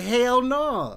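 loud, high, retold outburst; emphatic refusal. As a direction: refuse outright, throwing the voice up high. Acted flat refusal to brother.

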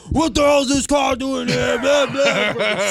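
high, forceful trooper yell; mock screaming. State trooper screaming at the driver coordinator, mock screaming. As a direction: imitate an irate yell, trailing into blahs. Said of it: "The state trooper starts screaming at me".

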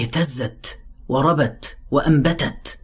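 اهتزت وربت وأنبتت recited slowly and softly, clipping each sukun; slow tajweed recitation. Shown as a demonstration. Teacher reciting the verse to show pulsing motion. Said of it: "فتحس هنا إن حركة الماء".

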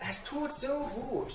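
high, drawn-out mock relish; relishing bully. Speaker voices a cyberbully enjoying venting frustration, slow high relish. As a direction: savour it slowly as the bully.

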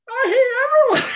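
put-on high, raspy old man voice from Family Guy; Herbert voice. Grumpy veteran patient griping about everyone, raspy high old man voice. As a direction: grumble it like a creepy old man who hates everyone. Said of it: "That was actually pretty good".

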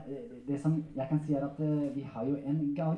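ordinary delivery

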